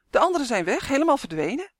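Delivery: high, dismayed announcement; alarmed cry. A narrator's reading. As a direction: call out the bad news. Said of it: "riep Uf opeens".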